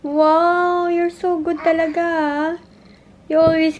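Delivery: high, sing-song mommy praise. Real, not acted. Mother praises child for mopping, high-pitched praise.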